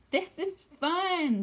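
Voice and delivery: all the animals delighted; joyful animals cheering; cheerful. Acted. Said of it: "they all said".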